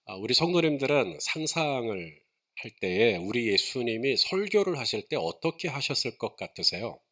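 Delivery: ordinary talk, nothing marked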